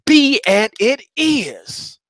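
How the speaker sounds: slow, heated mantra; a little angry. Shown as a demonstration. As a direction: spit it out slowly with rising anger. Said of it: "start kinda getting a little angry about it".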